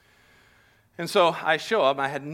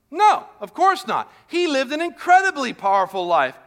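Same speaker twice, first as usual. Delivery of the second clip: emphatic preaching; 11 semitones higher, 8 dB louder than usual. Real, not acted. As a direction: drive the answer home from the pulpit. Preacher answers his own question.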